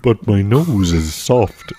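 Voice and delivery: slow talking-horse character. Pretend. Friend joins the horse-head joke, slow horse voice. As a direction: draw it out in a put-on horse voice. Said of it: "That's the ultimate horse voice".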